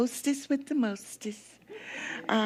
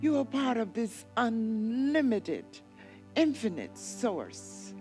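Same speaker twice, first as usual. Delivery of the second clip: guided meditation; 5 dB quieter than usual. Acted. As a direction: lull them gently down the river.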